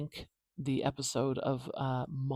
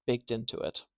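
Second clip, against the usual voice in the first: ordinary talk, nothing marked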